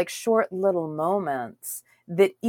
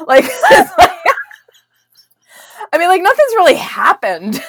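laughing off fear, higher and louder; nervous laughter; 11 dB louder than usual. Real, not acted. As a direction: giggle through it, flustered. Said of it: "the nervous laughter".

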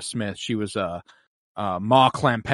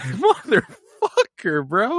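slow, higher, laughing through the swear; real laughter, half-mock anguish; laughing curse; 11 semitones higher, 3 dB louder, much slower than usual. Real, not acted. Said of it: "I was like".

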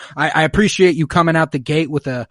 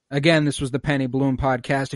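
ordinary talk

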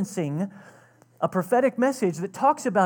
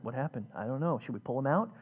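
murmuring crowd; 6 semitones lower, 6 dB quieter, faster than usual. Pretend. Worried crowd outside the temple.

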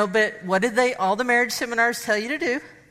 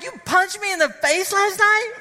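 outraged disbelief; 9 semitones higher than usual. Retold or imitated. Wife learns she was punched, incredulous. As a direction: fire it back in shock. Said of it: "I'm like".

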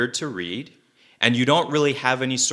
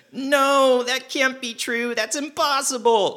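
much higher, mock-whiny young Luke. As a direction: squeak it out like a melodramatic young hero. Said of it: "And then Luke says".

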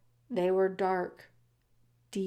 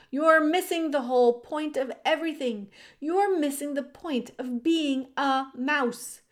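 higher, insistent brotherly telling-off; exasperated scolding; 6 semitones higher, 4 dB louder than usual. A narrator's reading. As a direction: press the point on a wayward brother.

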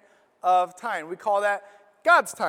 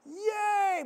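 mock cheer; 11 semitones higher than usual. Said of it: "I'm stuck in the spot like".

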